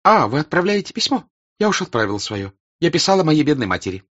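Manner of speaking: fast, high, lively, French r; quick bright French burr. A narrator's reading. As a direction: chatter quickly in a high voice with a burred r. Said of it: "заговорила быстро, приятным, сочным голосом".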